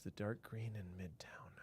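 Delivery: whispered to himself while searching; a thinking whisper. Real, not acted. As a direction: whisper the question under the breath. Repeats chat question to himself.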